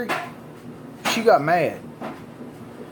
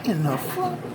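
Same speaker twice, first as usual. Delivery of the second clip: higher, mock startled outburst; shocked disbelief; 10 semitones higher than usual. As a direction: act out stunned surprise at the teeth. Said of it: "I'm like".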